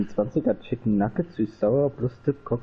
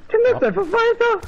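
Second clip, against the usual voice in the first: high excited yell; excited shout; 5 dB louder than usual. Real, not acted. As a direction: yell it in disbelief.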